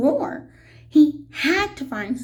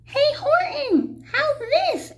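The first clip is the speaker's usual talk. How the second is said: tiny mayor calling up; 12 semitones higher than usual. A narrator's reading. The tiny mayor calls up to Horton over the racket. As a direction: call up eagerly from far below. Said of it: "the mayor called up".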